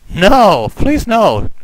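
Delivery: high, lightly acted plea; pleading mother. Mother hearing her son was killed, lightly acted pleading.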